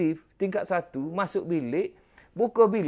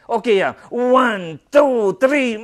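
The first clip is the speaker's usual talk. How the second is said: louder count to push; urgent count; 4 semitones higher, 8 dB louder, much slower than usual. Retold or imitated. Counting wife through the push.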